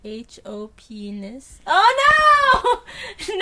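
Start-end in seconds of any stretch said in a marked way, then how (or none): 1.7-3.4 high shocked cry of no; horrified realisation. Real, not acted. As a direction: groan at falling for it. Fell for the ness trick.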